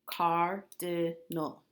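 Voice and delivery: very slow, syllables stretched apart. Shown as a demonstration. Teacher models cardinal for third graders, very slowly. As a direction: sound it out slowly for the class. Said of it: "is three syllables".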